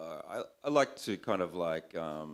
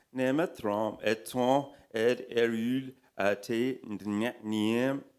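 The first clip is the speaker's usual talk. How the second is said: higher, mock French incantation; backwards French accent; 5 semitones higher, 4 dB louder, slower than usual. A narrator's reading. Devil stealing children answers in backwards French accent, intoned. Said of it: "muttering in his best, better backwards French accent".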